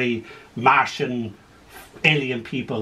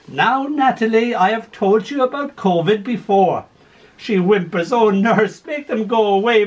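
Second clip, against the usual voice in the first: lively story poem read aloud; 5 semitones higher than usual. Acted. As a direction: tell it with gentle humour. Reads a poem with voiced figures.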